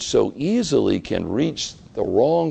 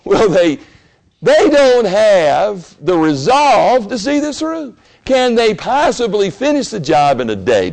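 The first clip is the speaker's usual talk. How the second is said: laughing, high and loud contempt, slowed; scornful mockery; 9 semitones higher, 6 dB louder than usual. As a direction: scoff with a laugh. Preacher mocks as Sanballat.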